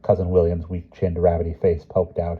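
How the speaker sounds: ordinary delivery, nothing marked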